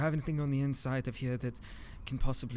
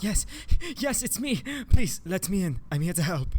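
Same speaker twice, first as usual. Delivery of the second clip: urgent pleading; 7 semitones higher, 6 dB louder than usual. Acted. Begging to be let in.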